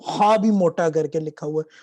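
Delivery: ordinary delivery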